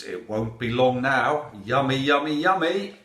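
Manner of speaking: loud, rising relish; gleeful hungry wolf. A narrator's reading. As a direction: build up greedily to a high finish. Wolf relishes his coming meal.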